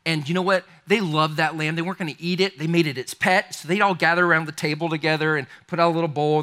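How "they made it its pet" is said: ordinary talk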